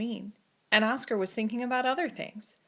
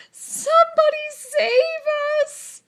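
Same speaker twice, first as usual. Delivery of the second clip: very high, loud, slow terrified shriek; 11 dB louder, slower than usual. A narrator's reading. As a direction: scream for help. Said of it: "shrieked the dogs".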